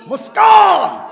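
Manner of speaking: high, loud cry of the name; calling out. Real, not acted. Preacher answers his own question, shouting her name. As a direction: proclaim the name to the crowd.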